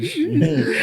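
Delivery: a high laugh at himself. Real, not acted. Host laughing at his own accent slip, a high laugh.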